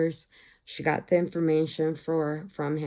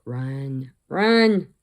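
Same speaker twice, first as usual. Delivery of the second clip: warning yell; 2 semitones lower, 7 dB louder than usual. Retold or imitated. Teller shouting at the movie heroine, rising to a yell. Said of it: "And I'm like".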